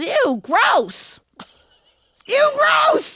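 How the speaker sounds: mother reacting to the photo; mom's ew gross. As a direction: squeal it like a grossed-out mom. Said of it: "And she goes".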